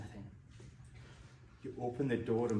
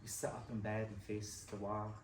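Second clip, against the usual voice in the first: ordinary delivery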